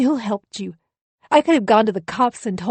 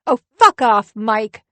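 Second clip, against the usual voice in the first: furious shout; 3 semitones higher, 9 dB louder than usual. Acted. Wife screams at her husband in fury. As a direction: blast it at him.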